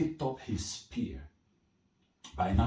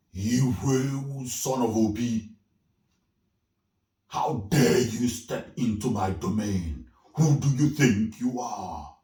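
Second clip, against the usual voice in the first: loud, menacing forest spirit demanding an answer; booming spirit voice; 3 semitones higher, 9 dB louder than usual. Acted. Forest spirit threatening the hunter in its domain, booming. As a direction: boom it out like an angry spirit.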